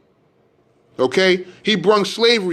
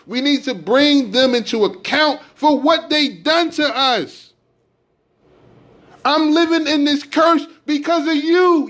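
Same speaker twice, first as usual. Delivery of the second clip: raised, pitch-lifted sermon rant, stretching key words; fiery preaching; 7 semitones higher than usual. Acted. Preacher railing at listeners about their elders, fiery sermon. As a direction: preach it hard at the congregation, voice climbing.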